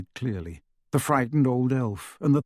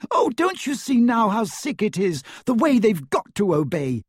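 much higher, anguished outburst; distressed Hermione; 10 semitones higher, 3 dB louder than usual. A narrator's reading. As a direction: plead in distress, pitched right up. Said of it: "Hermione cried".

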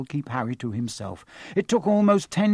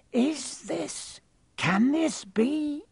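high, slow, hushed wonder; barely daring to ask; awed disbelief; 13 semitones higher, much slower than usual. Acted. Bartender staring at Harry, high and awestruck.

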